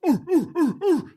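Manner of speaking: high hooting "ooh" animal calls; imitated seal calls. Imitating seals smashing chests, high hooting calls. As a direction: hoot repeatedly like an elephant seal. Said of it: "While they're going like".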